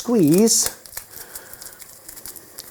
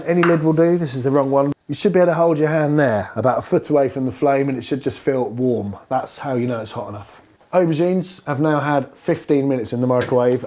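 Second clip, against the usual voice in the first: ordinary talk, nothing marked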